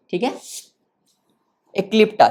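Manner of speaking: ordinary talk, nothing marked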